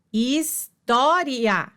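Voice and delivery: "história" slowly, his-tó-ria; divisão silábica. Shown as a demonstration. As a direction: break "história" into syllables, keeping ria whole. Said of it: "semivogal mais vogal na mesma sílaba". ("história" devagar, sílaba por sílaba)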